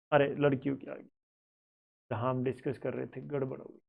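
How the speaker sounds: low aside, fading very soft; quietly notice a mix-up, half to yourself; muttering to himself. Real, not acted. Notices mistake, talks to himself.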